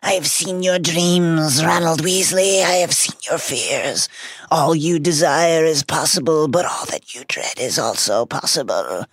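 theatrical dark-magic intoning; sinister Horcrux voice. Acted. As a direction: taunt like a haunted locket reading his soul. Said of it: "But the Horcrux continues".

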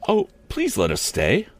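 put-on high-pitched girlish plea; pleading. A narrator's reading. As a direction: plead sweetly in a schoolgirl's high voice. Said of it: "pleaded Mary Nestor".

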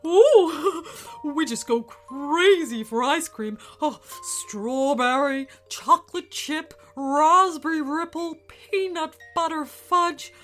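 loud, giddy creature raving; plant eating dinosaur. Acted. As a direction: swoon over every flavour. Kevin raves about ice cream.

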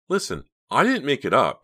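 high, loud protest; upset self-defence; insistent. A narrator's reading. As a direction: insist urgently, pitch soaring. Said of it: "Pam insisted".

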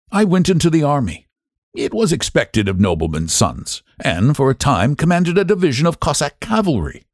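a proud aristocrat telling his life story; general's voice. A narrator's reading.

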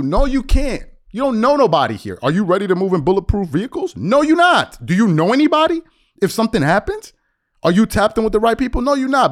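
high, loud retort from a retold argument; heated rebuttal. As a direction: shoot him down loudly, pitch way up. Retold argument, challenging the man.

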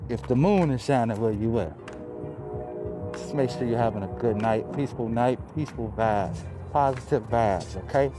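ordinary talk

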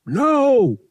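high, loud alarmed yell. A narrator's reading. Han shouting as pirates attack, loud yell. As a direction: shout it at the screen. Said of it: "Han yelled".

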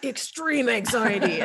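slow booming monster truck ad announcer. Shown as a demonstration. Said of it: "read it in, like, a monster truck voice".

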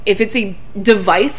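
normal talking voice

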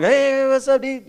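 mock casual greeting. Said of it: "I say "dude" a lot".

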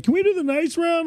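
high-pitched imitation request; pleading players. Bingo crowd asking the caller for nice round, high mock plea. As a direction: ask sweetly in a high little voice.

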